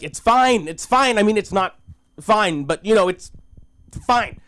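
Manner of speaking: high-pitched, awkward soothing; flustered reassurance. Acted. Awkwardly calming the crying girl.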